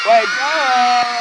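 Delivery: drawn-out wake-up call. Acted. Waking the animal who dozed off, calling out. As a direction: call it to rouse a sleeper. Rousing a sleeper with the audience.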